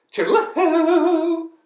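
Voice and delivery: loud, very high bird whoop; calling out. Acted. Bird flies off on holiday, high loud call. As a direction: sing out a long, gleeful farewell. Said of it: "called out the lazy bird".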